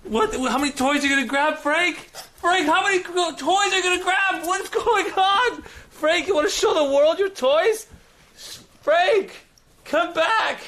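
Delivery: high-pitched gushing at the dog; squealing dog-owner excitement; over-excited pet talk. Real, not acted. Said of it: "I'm a little too excited".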